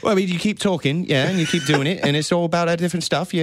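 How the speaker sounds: put-on British accent, bits-and-bobs pub talk. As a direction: keep up the mock British accent for the friends. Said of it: "your accent got better".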